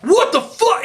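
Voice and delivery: high, mock-furious yelp; hammed-up outrage at a light graze; retold angry outburst. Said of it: "he loses his fucking shit".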